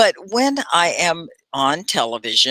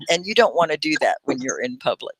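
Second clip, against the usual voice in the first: ordinary delivery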